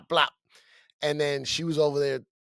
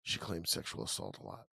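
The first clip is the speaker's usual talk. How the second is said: hushed, much softer warning said close; whispered warning; 9 semitones lower, 14 dB quieter than usual. Retold or imitated. Guys at the bar quietly warn the comic, hushed. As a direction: lean in and warn him quietly. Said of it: "whispered to me".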